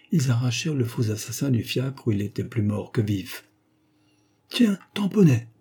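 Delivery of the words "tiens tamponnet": high-pitched cry of recognition; exclaiming. A narrator's reading. Passer-by recognises his friend, exclaiming in surprise. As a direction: call out in surprise. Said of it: "s'écria un passant".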